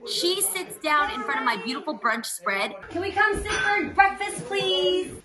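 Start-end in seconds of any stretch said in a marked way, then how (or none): 2.9-5.1 mock-sweet higher mom voice; mom asking. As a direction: ask politely as the mom would. Daughter acts out her mom.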